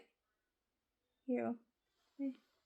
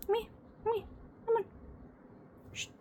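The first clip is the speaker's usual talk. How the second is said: ordinary talk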